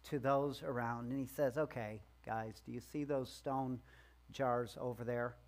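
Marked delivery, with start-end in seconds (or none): 1.6-5.4 low, soft, weary directions; quietly put-upon, energy drained; exasperated sigh. Pretend. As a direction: give orders as if sighing. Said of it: "with kind of an exasperated sigh going, giving directions".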